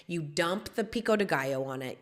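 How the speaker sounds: ordinary talk, nothing marked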